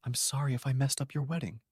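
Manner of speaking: put-on soft, trembling little boy; small kid, voice quivering softly; quivering child voice. A narrator's reading. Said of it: "he said in a quivering voice".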